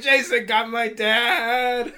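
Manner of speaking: high, loud, slowly stretched wail; mock panicked wail. Kid telling mom about dad, mock wail. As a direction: cry it out in hammed-up kid panic. Repeats the panicked cry from anecdote.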